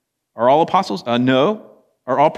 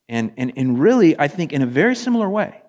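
ordinary talk, nothing marked

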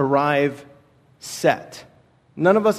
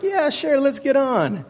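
mock eager yes; 9 semitones higher, slower than usual. Retold agreeing to horse ride.

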